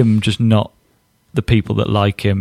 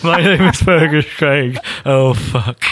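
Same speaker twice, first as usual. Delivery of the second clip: raised, laughing mimicry; teasing, higher-pitched echo; mocking the host; 3 semitones higher, slower than usual. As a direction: parrot the botched sign-off through laughter. Co-host mimics the botched sign-off.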